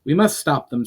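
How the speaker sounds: a strong, insistent order; emphatic. A narrator's reading. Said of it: "said Valinor emphatically".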